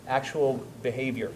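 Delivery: ordinary delivery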